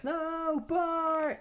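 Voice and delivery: very high-pitched scream of no; anguished cry. Retold or imitated. Iris watches rogues shoot Bart, high scream. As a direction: shriek it as he's shot. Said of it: "She's like".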